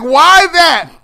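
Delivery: normal talking voice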